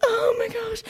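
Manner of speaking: high, softish mock gasp of alarm; anxious onlookers. Family watching their boy on stage, high anxious gasp. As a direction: act out nervous parents watching. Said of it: "This is us watching".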